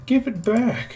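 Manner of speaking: put-on high-pitched girly plea; high girl voice. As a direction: squeak it out like a young girl. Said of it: "she just says".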